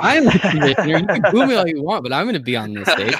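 cocky, laughing heel commissioner; sneering commissioner. Pretend. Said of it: "He's a commissioner now".

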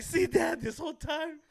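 high, loud, whiny sing-song of a wronged son; milking it. Retold or imitated. Brother guilt-tripping dad at the doctor, high whiny sing-song. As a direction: ham up the hurt kid guilt-tripping his dad. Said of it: "my brother fucking milked it".

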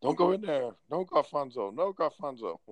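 lightly voiced high pleading teammate; imitating Japanese player. Japanese teammate begging Gonfonzo not to enter, high. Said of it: "this Japanese dude, was like".

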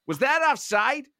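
a lightly mocking American accent. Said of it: "at one point he went".

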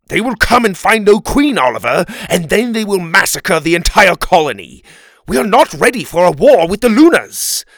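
loud, high, raging warning; king shouting in fury; furious. A narrator's reading. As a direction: rage at him about the coming disaster. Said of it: "The king looked at Oliver furious".

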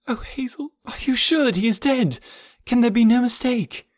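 high, distraught questions; desperate pleading. Acted. Jane begging Hazel for doubt about the death, desperate. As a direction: build from a faint appeal to urgent pleading.

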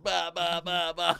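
high mock blubbering, bah bah; mock crying. Mocking a crying guy in the cop car with a high bah bah. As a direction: imitate a bawling kid sobbing.

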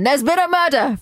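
weak attempt at a Scottish accent; half-hearted Scottish TV detective voice. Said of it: "That was not my Scottish accent".

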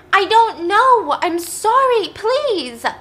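high, loud, drawn-out begging; desperate pleading. Acted. Beaten daughter begging her dad to stop, desperate high plea. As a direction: cry out as the frightened girl.

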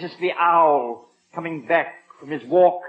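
usual speaking voice

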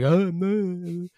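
put-on high loud drunken whine; drunk imitation. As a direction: play the drunk friend, hammed-up. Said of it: "And you're like".

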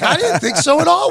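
high-pitched plea of innocence; acted-out indignant denial; defensive protest. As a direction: protest being blamed, pitched well up. Acted denial of being terrible.

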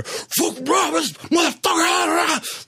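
high, slow street yell; man outside screaming at night, imitated high and drawn out. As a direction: hurl it out like a man raving on the street. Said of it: "back to the screaming".

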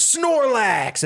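high, mock-heroic call; battle summons. Kid throwing out his Pokeball to fight, triumphant summoning call.

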